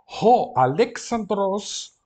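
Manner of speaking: declamado alto e lento; leitura em voz alta. Shown as a demonstration. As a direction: proclame o nome para a turma. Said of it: "reads Greek aloud for class". (o professor lê o grego à turma, alto e lento)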